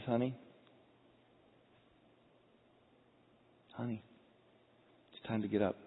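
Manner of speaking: soft, gentle wake-up call; incredible tenderness. Acted. Jesus waking the dead daughter, soft tenderness. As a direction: coax gently, with long pauses. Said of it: "in incredible tenderness".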